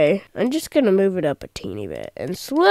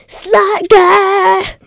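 high, loud announcer call; hero announcement; 9 semitones higher, 6 dB louder than usual. Acted. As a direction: hype him up like a hero arriving. Announcing a new character.

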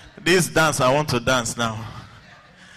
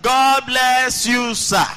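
high, loud drawn-out classroom greeting; pupils' chorus; 10 semitones higher, 4 dB louder, slower than usual. Retold or imitated. Pupils greet the new teacher, high chanted chorus. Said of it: "the student bang table".

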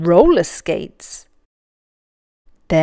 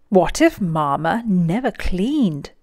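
plain reading voice

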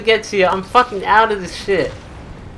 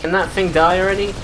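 ordinary delivery

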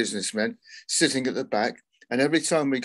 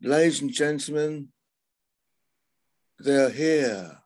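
higher, drawn-out mock stage announcement; mock compere; 4 semitones higher than usual. Musician retelling a clueless compere's announcement, mock showman. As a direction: ham up a compere who forgot the band's name.

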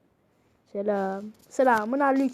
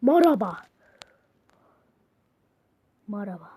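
ordinary talk, nothing marked

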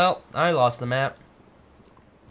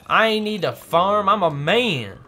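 slow, higher, louder macho boast; mock macho; 6 semitones higher, 7 dB louder, slower than usual. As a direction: puff up like a tough guy.

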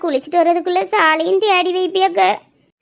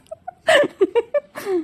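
higher-pitched falling laugh; 4 semitones higher, 4 dB quieter than usual. Acted. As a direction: laugh at a cheeky reply. Laughs at a joking reply.

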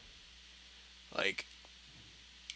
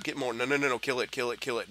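usual speaking voice

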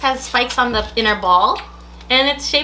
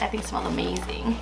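soft whispered aside; 6 semitones lower, 10 dB quieter than usual. Real, not acted. Owner confiding the treat smells good, whispered. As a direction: breathe it out as a secret.

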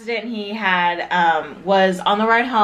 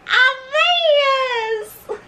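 high excited sing-song cry; giddy announcement. Real, not acted. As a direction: belt the name out with delight. Excited name reveal, sung out.

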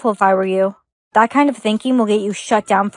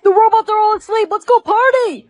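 high, excited call to party; loud party call; 10 semitones higher than usual. Acted. Partygoer rousing friends while the robots sleep, loud and high. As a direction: whoop it up in a raised, high pitch. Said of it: "Why are you so loud".

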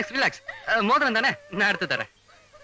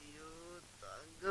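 sick moaning; 3 semitones lower, 29 dB quieter, much slower than usual. Pretend. Patient unable to bear it, faint whimper. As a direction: whimper it weakly.

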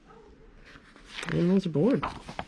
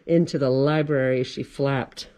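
usual speaking voice

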